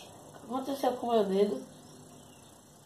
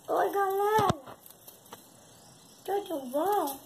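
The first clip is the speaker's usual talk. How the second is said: parakeet voice; 9 semitones higher, slower than usual. Pretend. Voicing the parakeet greeting.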